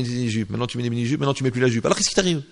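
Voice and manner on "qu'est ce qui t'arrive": normal talking voice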